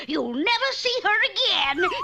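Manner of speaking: high, loud gloating threat; gloating witch threat. Acted. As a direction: crow it at the prince.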